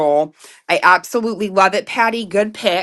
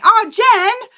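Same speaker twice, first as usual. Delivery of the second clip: high, loud delighted call; excited greeting; 5 dB louder than usual. Real, not acted. Host greets Jen back on tonight's live, excited. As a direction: greet a friend with a burst of excitement.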